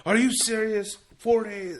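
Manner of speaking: high, loud incredulous outburst; imitated flipping out. Boss hearing of four days off, mock outrage. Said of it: "He was flipping out on me".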